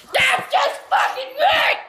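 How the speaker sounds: slow, high, loud sarcastic yell; furious shout. Real, not acted. Gamer yells sarcastically after another death. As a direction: roar the sarcasm out.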